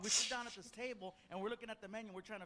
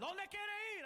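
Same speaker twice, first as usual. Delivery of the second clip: higher, pitched-up yell of the cab driver; mock yell; 11 semitones higher, slower than usual. Mimicking the cab driver yelling at them, mock yell. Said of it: "So he's yelling at us".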